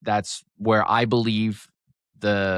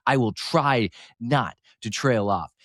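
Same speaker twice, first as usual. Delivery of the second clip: slow, deliberately enunciated words; measured pace, making an effort not to trail off; careful enunciation; slower than usual. Shown as a demonstration. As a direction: enunciate each word right to the end. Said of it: "I need to enunciate more and not trail off".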